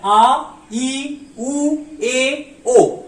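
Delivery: आ, ई, उ, ए, ओ each stretched and separate; pronunciation demo. Shown as a demonstration. Teacher reciting the five-letter line, drawn-out pronunciation demo. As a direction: hold each pure vowel, finish on a firm falling ओ. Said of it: "आ line में total five letters".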